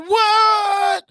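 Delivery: shouting surprise at a friend's new avatar; surprised shout. Real, not acted. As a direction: yelp in disbelief at the new photo.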